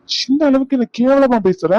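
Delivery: high pitched indignant cry; hurt and furious at once; angry outburst. Acted. Husband indignant at wife's insult, angry outburst.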